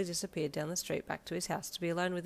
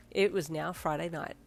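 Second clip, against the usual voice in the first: plain reading voice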